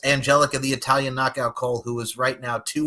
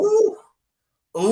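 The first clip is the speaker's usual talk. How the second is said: impressed ooh; 6 dB louder than usual. Real, not acted. Reacting to bold prediction.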